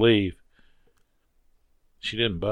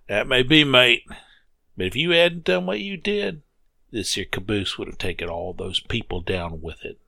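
put-on a kindly old hand reassuring his mate; old Joe comforting the narrator; gruff old railroader; 3 semitones higher than usual.

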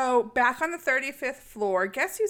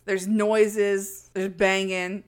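normal talking voice

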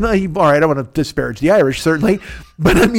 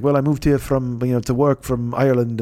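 Irish lilt; 5 semitones lower, 4 dB quieter than usual. Retold or imitated. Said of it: "He had the lilt".

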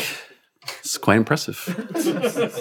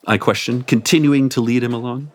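plain reading voice

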